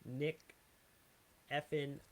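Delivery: normal talking voice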